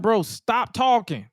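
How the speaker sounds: high, slow, drawn-out mock begging; exasperated plea. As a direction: beg him to stop, stretching it out. Imagined plea to wrestler to stop.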